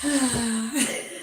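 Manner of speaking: long breathy sigh melting into "oh"; acted-out sigh of calm relief; relieved sigh. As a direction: let all the tension out in a sigh. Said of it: "as soon as I'm hear Janie's voice".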